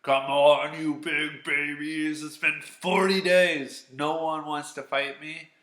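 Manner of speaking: Goliath jeering at the army; booming bully taunt; taunting giant. Retold or imitated. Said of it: "And he's like".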